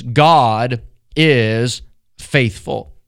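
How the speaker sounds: each word laid down slowly; slow, weighty proclamation. Shown as a demonstration. Preacher unveiling his text to listeners, slow and weighty. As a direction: unveil the key statement slowly and heavily. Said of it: "Here it is".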